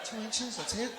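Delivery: high-pitched imitation of a let-down listener; mock disappointment. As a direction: question it high, as if underwhelmed. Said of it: "You sounded disappointed".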